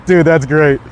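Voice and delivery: ordinary talk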